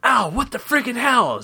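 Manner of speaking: high, put-on indignant knocker voice; pained left head. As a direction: protest as a creature just banged on. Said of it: "said the left head".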